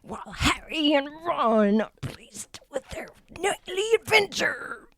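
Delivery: hammed-up Gollum, slow and high. Shown as a demonstration. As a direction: rasp it out like Gollum. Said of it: "who I was trying to channel? Gollum".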